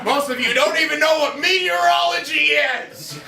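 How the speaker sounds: high, sustained comic scolding; playful ranting reproach; mock berating. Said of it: "this is the part where I berate the audience".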